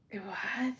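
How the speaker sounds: whispered, disbelieving question; baffled whisper. Real, not acted. Host reacting to crazy quiz questions, baffled whisper.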